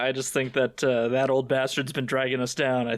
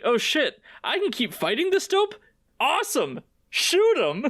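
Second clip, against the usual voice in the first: mock eager; 11 semitones higher than usual. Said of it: "Goku's like".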